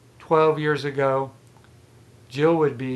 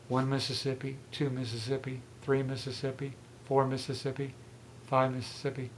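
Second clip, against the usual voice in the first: softly counting off the seconds; quiet counting; 2 semitones lower, 10 dB quieter than usual. Shown as a demonstration. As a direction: murmur the count under your breath. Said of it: "I'll pause here for five seconds".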